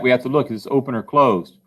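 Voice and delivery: normal talking voice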